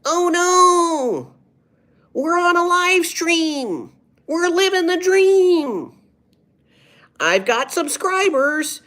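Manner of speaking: very high, loud, drawn-out yelling comic; Aziz Ansari. Acted. Streamer impersonating a comedian for the chat, exaggerated high yelling. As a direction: stretch every phrase into a slow, high-pitched shout. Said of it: "Let's do an Aziz Ansari impression".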